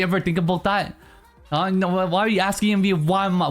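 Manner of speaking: normal talking voice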